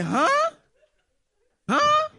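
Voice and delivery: high-pitched, rising disbelief; taken aback. Retold or imitated. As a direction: re-enact a baffled double take. Taken aback by son's remark.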